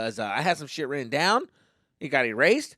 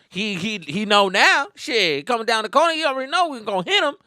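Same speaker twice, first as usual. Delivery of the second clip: higher swaggering storytelling patter; mock street talk; 5 semitones higher, 3 dB louder than usual. Friend bragging about a fight on the corner, imitated street talk. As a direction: riff boastfully in a put-on street voice.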